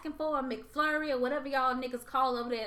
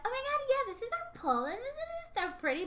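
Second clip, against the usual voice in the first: much higher, sugary sales-agent voice; fake professional niceness; 11 semitones higher, 4 dB quieter than usual. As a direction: put on the polite tour-guide voice for clients. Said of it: "trying to be professional and, like, be nice".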